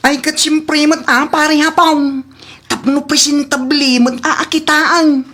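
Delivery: playful high ribbing; teasing buddy voice. Acted. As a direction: lift the voice up to tease your pal. Teasing friend about his outfit.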